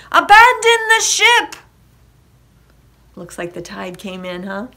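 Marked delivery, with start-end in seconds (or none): 0.1-1.6 high, loud shouted order; urgent cry. A narrator's reading. Captain to crew as the ship slips, shouted order. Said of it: "cried the captain".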